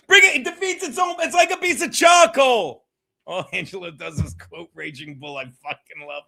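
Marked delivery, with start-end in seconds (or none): 0.1-1.1 loud, fast outburst; fired-up shout. Real, not acted. Heated rant, repeated later. 1.2-2.8 loud, fired-up rant; heated rant. Real, not acted. Heated rant about lying.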